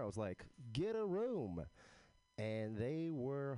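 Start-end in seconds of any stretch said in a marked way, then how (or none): 0.7-1.6 playful teasing call-out, pitched high. Retold or imitated. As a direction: tease them with a higher, lilting shout. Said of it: "in a very playful manner".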